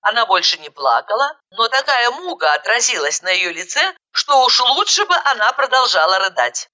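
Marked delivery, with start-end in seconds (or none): none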